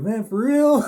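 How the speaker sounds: a hooting high "for real?"; amused disbelief. Retold or imitated. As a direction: imitate a laughing cellmate who can't believe it. Inmate laughs at first-timer.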